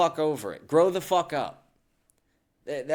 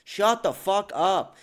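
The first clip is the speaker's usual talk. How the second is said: slow, higher-pitched exasperated order; angry dismissal; 5 semitones higher, slower than usual. Real, not acted. Ranting at people who claim persecution, angry and slow. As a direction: draw it out in real irritation.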